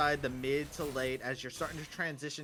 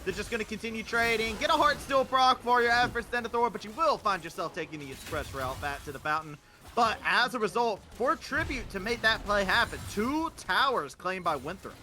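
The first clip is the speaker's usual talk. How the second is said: quick, punchy, higher-pitched shoutcasting; live play-by-play; 8 semitones higher, 6 dB louder than usual. Real, not acted. Caster calling a tower trade for viewers, energetic play-by-play. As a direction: call the action with energy.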